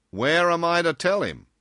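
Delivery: loud, high, brisk question; inquiring boss. A narrator's reading. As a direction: ask for the address in full voice. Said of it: "he inquired".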